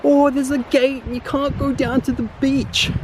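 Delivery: high, prissy rule-reader; mock officious voice. Mocking the beach authorities, high prissy voice. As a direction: parody the pompous gate rule. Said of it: "they got this".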